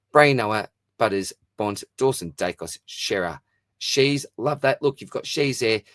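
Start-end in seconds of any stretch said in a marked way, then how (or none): none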